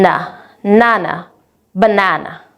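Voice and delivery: building na, nana, banana from the last syllable; "na" then "nana" then "banana", adding one syllable each time from the end; back chaining. Shown as a demonstration. Teacher modelling for students: back chaining na, nana, banana. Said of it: "teach them to pronounce syllable by syllable, starting backwards".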